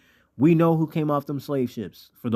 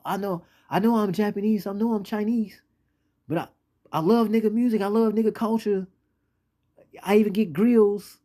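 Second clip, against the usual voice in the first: high-pitched, insistent protest in another man's voice; identity-crisis complainer; 9 semitones higher than usual. Acted. Outsider insisting he loves the culture, high-pitched pleading. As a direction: act out the torn outsider, pitched well up.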